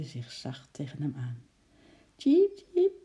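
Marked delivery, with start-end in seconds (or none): 2.2-2.9 very high little cheep; squeaking squirrel. A narrator's reading. Young squirrel snuggling up to ape, squeaking. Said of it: "piepte hij zachtjes".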